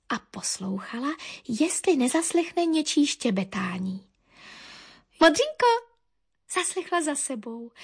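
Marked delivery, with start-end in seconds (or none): 5.2-5.9 high, loud bird call. A narrator's reading. Cousin calls to Modřinka from behind, high excited call. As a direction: call out to catch her attention.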